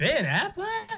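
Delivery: very high, laughing rapper impression. Repeating an actor's name to mock it, rapper impression. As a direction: squeal it in mock disbelief, rapper-style. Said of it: "I'm doing a [name] voice".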